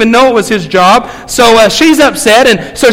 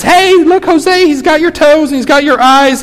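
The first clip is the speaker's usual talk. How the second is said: delighted new mother; 6 semitones higher than usual. Acted. New mother shows the baby.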